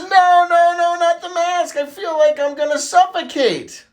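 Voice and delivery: high, drawn-out wailing refusal; hammed-up child panic, stretched out; panicked kid protest. Little Johnny refusing the dentist's mask, high acted panic.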